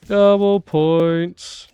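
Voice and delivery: slow booming announcer imitation. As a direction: drawl it like the game announcer. Mimicking the game announcer.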